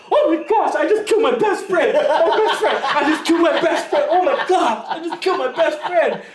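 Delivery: retold frantic high yell, laughing through it; panicked yelling. Training partner yelling over his choked-out friend, frantic laughing shout. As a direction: shriek it like you think you killed your best friend.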